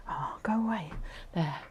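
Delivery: breathy whispered aside. Real, not acted. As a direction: mutter it under your breath.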